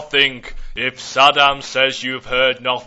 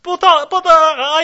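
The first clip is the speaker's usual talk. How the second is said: panicked stammer; 13 semitones higher, 7 dB louder than usual. Acted. Taxpayer protesting in panic.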